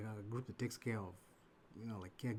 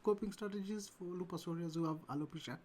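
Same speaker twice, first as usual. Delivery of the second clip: running out of breath, voice pushed higher; 8 semitones higher than usual. Real, not acted. Host asking about negative coping strategies, running out of breath. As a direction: squeeze the long question out on one breath. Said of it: "I ran out of breath there".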